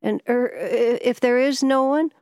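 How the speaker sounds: high, halting, uneasy question; stammering. A narrator's reading. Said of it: "he stammered".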